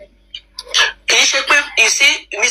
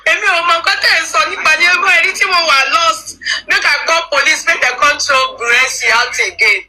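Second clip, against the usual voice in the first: higher, agitated outburst of confusion; confused exclamation; 6 semitones higher than usual. Real, not acted. A commentator baffled by the feud, pitched high and agitated. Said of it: "mo ṣe wà confused".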